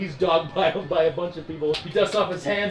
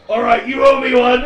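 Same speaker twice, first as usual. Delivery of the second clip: pirate quip; 7 semitones higher, 13 dB louder than usual. Acted. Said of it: "and he says".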